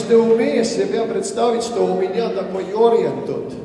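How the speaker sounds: normal talking voice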